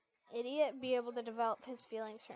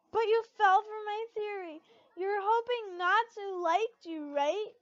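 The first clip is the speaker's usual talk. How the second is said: higher, louder bawling accusation; 7 semitones higher, 10 dB louder than usual. A narrator's reading. Levy bawling at Lucy for believing her theory, high and loud. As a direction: wail it out through tears. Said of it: "she bawled".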